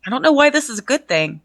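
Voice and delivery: laughing while asking, quick and amused. Real, not acted. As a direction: laugh through the line. Reader laughs at the text.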